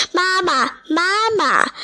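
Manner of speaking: high-pitched tadpoles' call; little tadpoles hailing their mother; calling for mama. A narrator's reading. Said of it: "就迎上前去叫".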